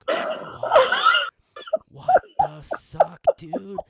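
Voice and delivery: high, loud, drawn-out shock; mock stunned disbelief. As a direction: act out stretched-out, squealed disbelief. Retold shock at friend passing out.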